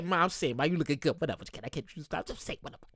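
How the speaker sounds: soft, low, half-whispered pickup line; sneaky near-whisper flirting; hushed mock come-on. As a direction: murmur it like a sly come-on.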